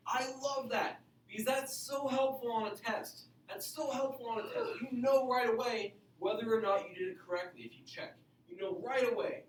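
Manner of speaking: lifted, eager teacher enthusiasm; excited. Real, not acted. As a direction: sell the joy of checking answers. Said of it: "I get excited about it".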